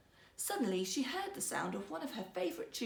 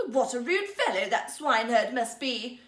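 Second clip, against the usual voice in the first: indignant princess; 8 dB louder than usual. Acted.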